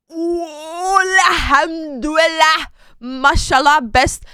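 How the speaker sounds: loud, high cry of delight; joyful shout of praise; elated exclamation. Real, not acted. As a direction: call out thanks, drawn out and loud. Rejoicing over favourite empanada shop.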